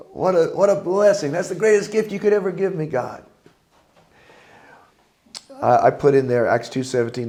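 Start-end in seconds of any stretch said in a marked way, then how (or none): 0.1-3.2 excited gratitude. Pretend. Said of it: "how amazing".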